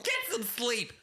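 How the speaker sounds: high-pitched nagging caricature; Fran. Acted. Caller signing off with advice, high Fran voice. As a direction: fuss in a put-on high voice.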